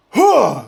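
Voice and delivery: sharp loud knightly "Huh!"; a short loud shout from the arena; knight's grunt. Retold or imitated. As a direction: bark it out like a knight at a show. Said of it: "they go, like".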